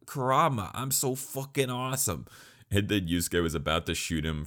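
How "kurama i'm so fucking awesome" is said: high-pitched, showboating boast; hammed-up, high gloating voice; mock bragging bully. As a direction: imitate a bully crowing over his win. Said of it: "that was a guy who was just like".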